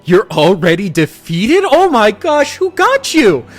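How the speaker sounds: high, loud squeal of disbelief; mock outrage. Salesman to chained-up captive he meant to ambush, mock outrage. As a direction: climb into a high, theatrical rant.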